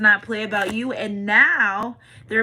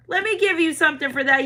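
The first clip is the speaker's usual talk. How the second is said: repeated much higher, generous and eager; high excited spirit voice; 8 semitones higher, 5 dB louder than usual. Acted. Spirits offering the viewer a gift, high excited voice.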